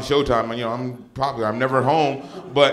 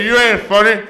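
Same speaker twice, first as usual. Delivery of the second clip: loud, high-pitched imitation of a combative mom; talking smack; 8 semitones higher, 12 dB louder than usual. Mom repeats her insult to her comic son, loud trash talk. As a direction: double down on the insult, louder and higher. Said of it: "she talks smack to me".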